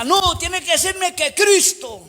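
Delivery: high, slow insistent call; retold shout. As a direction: insist loudly, stretched out. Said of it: "él me grita".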